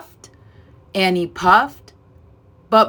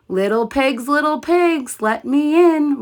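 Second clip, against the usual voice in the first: wolf roar; 6 semitones higher than usual. A narrator's reading. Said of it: "roared the wolf".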